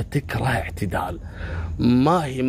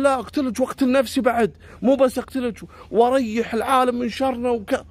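furious threat; 10 semitones higher than usual. Retold or imitated. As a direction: yell the threat back. Stalker yelling back.

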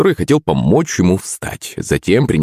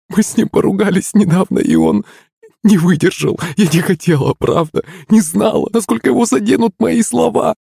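very high, tearful female voice; wailing; 12 semitones higher than usual. A narrator's reading. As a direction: plead in distress, climbing toward the end. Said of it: "завыла Пышка". (плачущее причитание)

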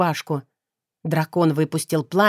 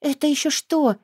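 higher, sheepish puzzled question; guilty mumble; 8 semitones higher than usual. A narrator's reading. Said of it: "виновато пробормотала я". (виновато, растерянно, выше)

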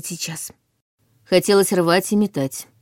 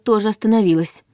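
ordinary delivery

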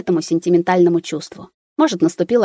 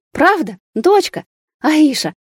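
much higher, louder joyful cry; overjoyed; 10 semitones higher, 4 dB louder, slower than usual. A narrator's reading. New father hearing of his daughter, overjoyed. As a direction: burst out in disbelieving joy.

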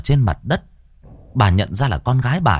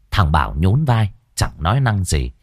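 plain reading voice

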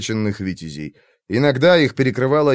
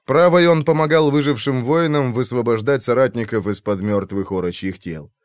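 ordinary delivery

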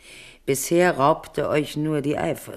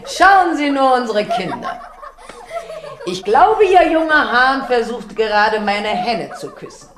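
higher, louder, laughing delight; amused laughter; 8 semitones higher, 4 dB louder than usual. Acted. Queen watching the children kiss, laughing. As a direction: laugh through the words at the children.

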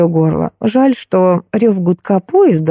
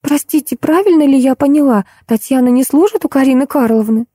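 put-on high, polite girlish voice; timid inquiry; 7 semitones higher than usual. A narrator's reading. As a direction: ask shyly in a small high voice. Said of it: "робко осведомилась Машенька". (робкий вопрос тонким девичьим голосом)

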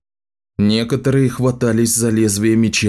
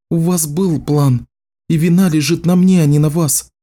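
Samantha's voice; 6 semitones higher than usual. Acted. Voiced young woman character.

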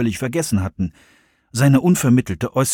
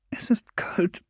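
sehr leise, tonlos gemurmelt; 7 semitones higher, 9 dB quieter, slower than usual. Acted. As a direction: ausweichend murmeln. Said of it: "murmelte Serenity". (Serenity murmelt leise eine Ausrede)

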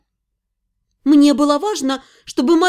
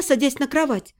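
ordinary delivery, nothing marked

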